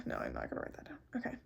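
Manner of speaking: voiceless aside; whisper. Real, not acted. Whispered aside to herself. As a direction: mutter it to yourself.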